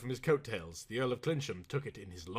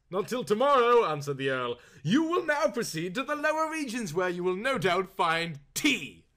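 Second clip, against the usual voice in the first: lordly Earl; 6 semitones higher, 5 dB louder than usual. Acted.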